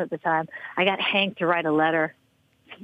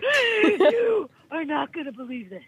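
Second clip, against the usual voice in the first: higher, slow, outraged mock rant; imitating angry teammate; 6 semitones higher, slower than usual. Brandy storming in with the letter, imitated outrage. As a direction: replay her fury at the letter, hammed-up. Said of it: "she is so pissed".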